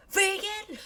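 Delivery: very high, appalled vegan; outraged character; appalled repeat. Acted. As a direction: squeal it in horror. Repeats in louder disbelief.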